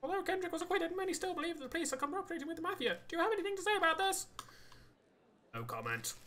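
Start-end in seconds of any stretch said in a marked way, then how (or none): 0.0-4.3 reporter voice. Acted. Reporter questioning at press conference.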